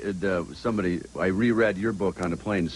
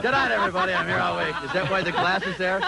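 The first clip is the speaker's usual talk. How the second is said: mock comedian sign-off; 9 semitones higher than usual.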